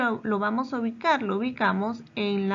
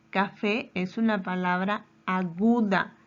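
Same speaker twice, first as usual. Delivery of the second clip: ordinary talk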